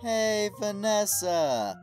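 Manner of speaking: ordinary talk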